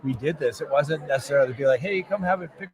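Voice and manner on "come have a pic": ordinary talk, nothing marked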